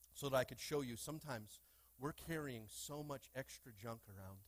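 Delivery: usual speaking voice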